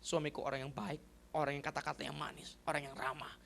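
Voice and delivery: soft, low, humble pleading; wife's prayer. Retold or imitated. Wife praying nightly for her harsh husband, soft pleading. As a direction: pray quietly, almost to yourself. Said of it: "tiap malam dia berdoa".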